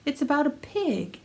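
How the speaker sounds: soft announcer intro over music; intro narration. Acted. Narrator introducing the series, soft announcer read.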